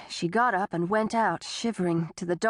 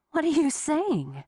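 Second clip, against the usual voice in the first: higher, alarmed question; 7 semitones higher than usual. Acted. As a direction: react in alarm. Tessa alarmed.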